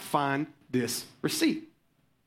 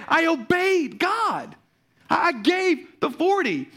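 fervent preaching; 8 semitones higher than usual. Real, not acted. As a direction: preach it home to the church. Preaching at full force.

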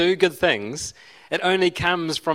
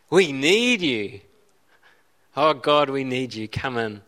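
raised, pleading prayer, slowing at the end; heartfelt worship prayer. Acted. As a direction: call God in earnestly. Voices a welcoming prayer.

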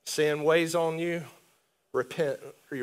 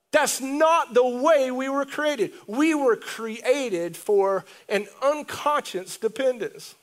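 raised pitch, drawn-out preacher's emphasis; emphatic preaching; 5 semitones higher, 4 dB louder, slower than usual. Real, not acted. Preacher telling the church how they were created, emphatic preaching. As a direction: preach it with rising conviction.

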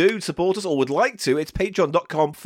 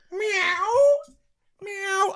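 much higher, crying meow; cat meowing; 13 semitones higher than usual. Acted. The cat Biscuits creeps out, meowing high and crying. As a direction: wail like a cat.

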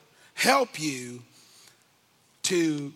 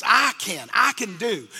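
ordinary delivery

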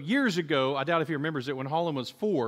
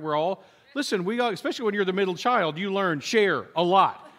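normal talking voice

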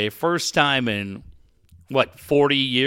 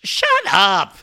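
annoyed retort; 5 semitones higher than usual. Pretend. Retort to mock Instagram post.

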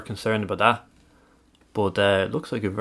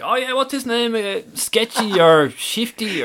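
imitating Dave; 11 semitones higher than usual. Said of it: "Dangerous Dave was like".

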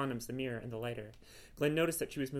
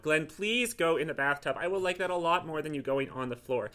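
exasperated plea; 3 semitones higher, 7 dB louder than usual. Acted. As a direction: beg the cat, thoroughly fed up. Woman exasperated, pleading with cat.

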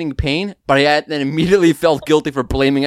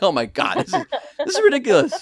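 higher, fast words through laughter; laughing in disbelief; 12 semitones higher than usual. Retold or imitated. Exasperated, laughing retelling.

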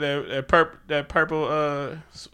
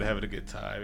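normal talking voice

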